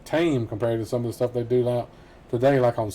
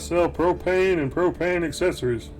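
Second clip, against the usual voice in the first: Hank Hill impression; 5 semitones higher than usual. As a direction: sell it proudly like a Texas propane dealer. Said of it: "King of the Hill".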